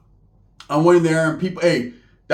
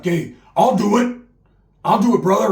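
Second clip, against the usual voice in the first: higher, louder, eager offer; eager volunteer; 4 semitones higher, 4 dB louder than usual. Retold or imitated.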